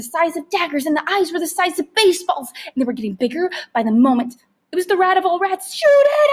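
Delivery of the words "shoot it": high, loud yell. A narrator's reading. Boy yelling at father to shoot. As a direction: scream the order in panic. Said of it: "I yelled".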